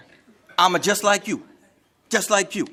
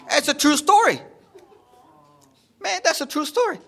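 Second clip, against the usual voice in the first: insistent high protest; 9 semitones higher than usual. Real, not acted. Insists story is true, high.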